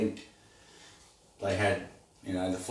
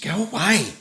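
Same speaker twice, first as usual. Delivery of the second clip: shooing voice; 10 semitones higher, 4 dB louder than usual. Real, not acted. Shooing the dog away.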